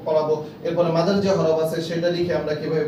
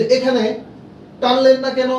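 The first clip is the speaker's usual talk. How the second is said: higher, louder questioning teacher; teacher's question; 6 semitones higher, 4 dB louder than usual. Acted. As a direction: challenge the student: why no stretch here?. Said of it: "শিক্ষার্থীদেরকে প্রশ্ন করা যে". (শিক্ষক জোরে প্রশ্ন করছেন)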